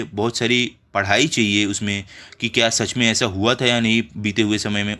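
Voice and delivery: normal talking voice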